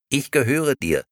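sweet, empty doll voice; talking doll. Acted. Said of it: "antwortete die Puppe".

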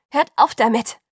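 loud, exasperated command; impatient shout. A narrator's reading. Bella breaks up the quarrel, shouting impatiently. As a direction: cut the quarrel short loudly. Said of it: "rief ich beiden ungeduldig zu".

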